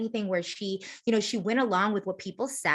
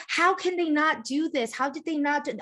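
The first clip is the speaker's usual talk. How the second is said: higher-pitched mock disbelief; incredulous complaint; 7 semitones higher than usual. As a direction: voice a baffled viewer's protest. Mimics baffled viewers' complaint.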